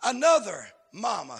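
emphatic revival cadence; fervent preaching. Real, not acted. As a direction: build a list with rising intensity, then proclaim it. Preaches at full force.

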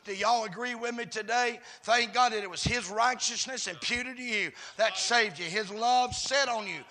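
loud, driving preacher's cadence; full-force preaching. Real, not acted. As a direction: preach it in punched, shouted phrases.

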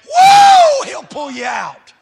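shouted, chanted preaching with whoops; full-voiced holler of a Southern preacher; preaching at full force. Real, not acted. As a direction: preach it loud, rising into whoops.

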